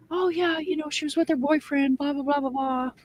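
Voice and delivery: high, airy, dismissive voice; mock breezy manager. Mocking the breezy McDonald's manager to friends, high dismissive. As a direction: brush it off with a blah blah. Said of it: "and say".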